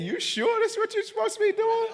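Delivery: high, doubtful quoted woman's voice; skeptical woman. Retold or imitated. She doubts his path, high skeptical voice.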